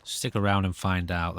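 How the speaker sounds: ordinary talk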